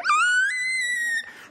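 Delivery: piercing scream. Retold or imitated. Parent shrieking to stop the kids, piercing yell. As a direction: shriek at sonic levels. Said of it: "it hit sonic levels".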